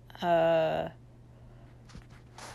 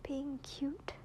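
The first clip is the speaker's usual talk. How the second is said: soft tearful whisper; 4 semitones higher, 6 dB quieter than usual. Real, not acted. Tearful goodbye to partner.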